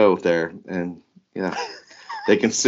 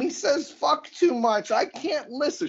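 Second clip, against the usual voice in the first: high-pitched mocking whine; whiny complainer. Host mimicking listeners who hate listen, high mocking whine. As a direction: put on a squeaky, fussy listener's voice.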